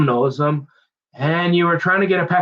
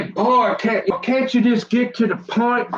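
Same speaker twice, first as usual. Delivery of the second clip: higher, mock-impatient listener asking him to hurry; 8 semitones higher than usual. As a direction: play the bored listener cutting in. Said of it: "You say".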